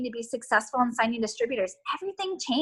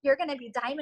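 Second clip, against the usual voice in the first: ordinary delivery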